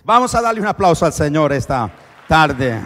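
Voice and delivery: loud, rousing call to applaud; rallying call. Real, not acted. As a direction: lift the whole room. Calling congregation to applaud.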